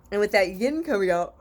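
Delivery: talking mid-yawn. Real, not acted. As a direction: yawn through the words. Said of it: "I keep yawning".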